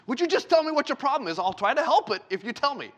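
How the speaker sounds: a dad losing patience with his crying daughter; exasperated dad. Retold or imitated.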